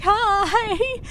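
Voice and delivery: long, high sing-out hello; high-pitched greeting. Retold or imitated. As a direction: call back to a friend, stretching it out. Calls greeting back to waving friend.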